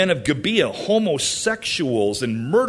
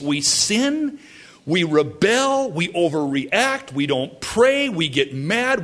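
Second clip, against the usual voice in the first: usual speaking voice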